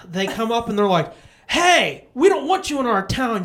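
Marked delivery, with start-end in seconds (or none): 1.5-3.4 loud, higher mock-bully shout; bullies' threat. Bullies warning two men out of town, shouted threat. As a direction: imitate a thug running someone off.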